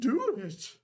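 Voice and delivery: high, loud put-on merchant voice; Goldak voice. A narrator's reading. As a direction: give the order in the merchant voice.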